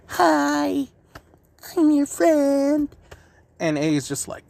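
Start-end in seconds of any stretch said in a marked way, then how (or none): 0.1-2.9 put-on high, slow sweet little-boy voice; innocent kid Naruto. Whiskered blonde boy greeting A, high and slow. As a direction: beam it out like a guileless child. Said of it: "little blonde boy with whiskers is just like".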